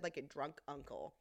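ordinary talk, nothing marked

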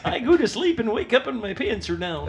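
lightly hammed-up old man; old man complaining about the nurse. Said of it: "ninety years old".

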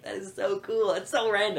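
high, amazed, a bit giddy; excited kid. Retold or imitated. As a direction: gush like a kid finding treasure. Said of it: "I'm like".

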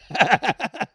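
high, loud burst; sudden yelp. Real, not acted. Outburst after bragging.

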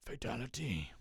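mock fighting game announcer. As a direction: announce it like Mortal Kombat. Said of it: "From Mortal Kombat".

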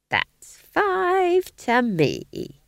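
put-on very high, very slow gleeful old witch; happily. A narrator's reading. Nanny Og gloating over her score, high and slow. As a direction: crow over your score. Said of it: "said Nanny happily".

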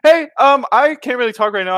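high, loud, hurried excuse; flustered brush-off. Retold or imitated. Hiding his cut from buddy's mom, flustered brush-off. Acting out his evasive reply.

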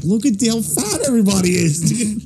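high-pitched pretend astonishment; mock amazement. Pretend newcomers off the plane marveling, mock amazement.

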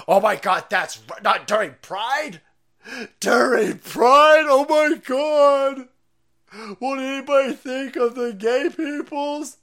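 high, loud, drawn-out mock shriek; shrieking parody of outrage. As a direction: ham up a scandalized activist. Mock outraged reaction to ruling.

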